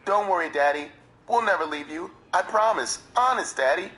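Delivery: put-on high child voice, earnest and pleading; high boy's voice promising his stepfather love; young boy's voice. A narrator's reading. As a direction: voice the ten-year-old clinging to his father. Boy's voice in retold scene.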